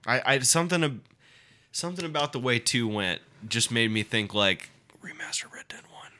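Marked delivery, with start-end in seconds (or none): 5.0-6.1 breathy hushed whisper; hushed wistful whisper. Retold or imitated. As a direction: whisper it like a secret wish. Imagined thought whispered.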